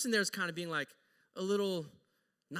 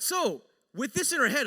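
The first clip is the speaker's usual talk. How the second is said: normal talking voice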